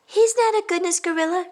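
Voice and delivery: high, loud protest; indignant child. Acted. Tina protesting to her teacher about Todd. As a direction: cry out in protest. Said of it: "cried Tina".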